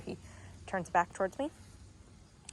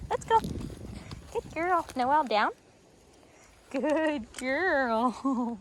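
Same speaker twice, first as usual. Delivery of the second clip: high, loud cue; very high let's go to the dog; eager let's go; 5 semitones higher, 5 dB louder, much slower than usual. Real, not acted. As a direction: urge the puppy on. Urging puppy on.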